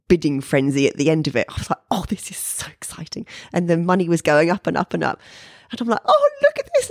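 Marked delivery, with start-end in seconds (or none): 1.9-3.3 hushed, thrilled whisper; excited whisper. Retold or imitated. Artist watching her painting's bids climb, whispering excitedly. Thrilled at auction bidding frenzy. 6.0-6.9 much higher, delighted exclamation; thrilled squeal. Retold or imitated. Artist showing her husband the rising bids, high and thrilled. Watching bids climb at auction.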